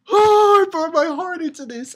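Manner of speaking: high, very loud wail, easing off; mock anguished outburst. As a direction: overact a wounded performer's protest. Acts out character's upset outburst.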